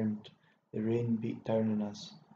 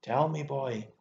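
louder, insistent order; fierce, raised-voice insistence; fierce demand; 3 semitones higher, 6 dB louder than usual. A narrator's reading. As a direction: press hard for an answer. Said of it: "caught my arm the more fiercely".

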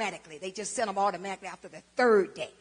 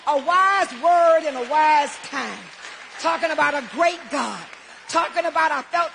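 full-force preaching; 3 semitones higher, 9 dB louder than usual. Acted. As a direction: preach it to the congregation.

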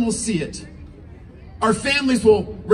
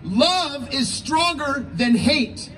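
preaching; 3 semitones higher, much slower than usual. Acted. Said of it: "The Lord said".

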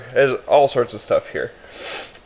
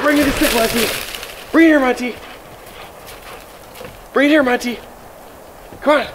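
much higher, squealy calls to the dog; high excited dog calls; 10 semitones higher than usual. Real, not acted. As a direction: coax the dog to bring the stick, high and eager.

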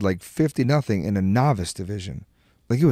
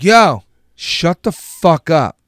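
slow, loud, higher-pitched outburst; retold angry shout; 6 semitones higher, 9 dB louder, much slower than usual. Telling off the screaming dad beside him, slow angry shout. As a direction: shut him up, stretching out each word.

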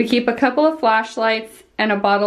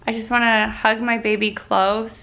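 normal talking voice

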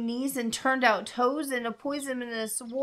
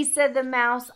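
ordinary delivery, nothing marked

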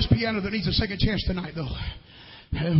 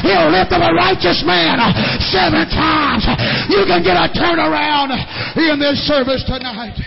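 higher and louder, a catch of crying; impassioned preaching; 4 semitones higher, 5 dB louder than usual. Acted. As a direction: preach it with tears near the surface. Peter and Mary story preached.

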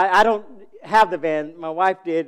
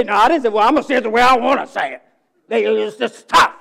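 mock defensive retort; 5 semitones higher, 8 dB louder, faster than usual. Mocking a defensive reply.

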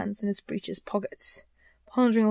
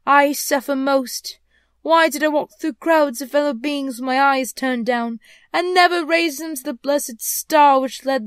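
suffering ghost; 5 semitones higher, 8 dB louder than usual. Acted. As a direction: reproach yourself like a grieving spectre. Voices the ghost in the story.

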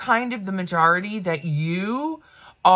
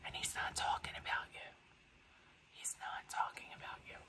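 fully whispered, very soft; pointed whisper; 23 dB quieter than usual. Real, not acted. Vlogger telling the woman he's not talking about her, whispering. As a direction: whisper it like a confidential truth, repeated for emphasis.

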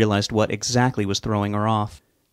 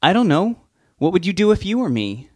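Sue's voice, higher, lightly voiced; Sue, unsure; 9 semitones higher, 3 dB louder than usual. A narrator's reading. Woman answering her troubled husband, higher and unsure. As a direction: throw the question back at him, helplessly.

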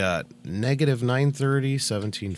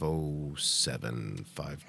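whispering; 6 semitones lower, 8 dB quieter, slower than usual. Real, not acted. Whispered coordinates.

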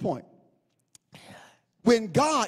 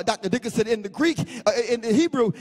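ordinary talk

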